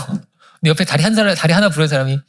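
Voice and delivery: usual speaking voice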